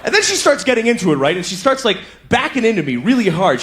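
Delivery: ordinary talk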